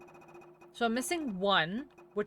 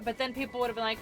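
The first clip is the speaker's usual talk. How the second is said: normal talking voice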